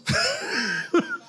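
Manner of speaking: high, bursting laugh at his own story; real laugh. Real, not acted. Laughs at God's irony.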